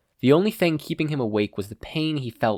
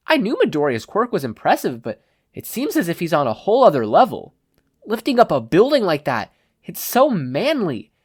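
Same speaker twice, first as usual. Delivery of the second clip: excited gush; 8 semitones higher, 6 dB louder than usual. Acted. Kirishima gushes about Midoriya.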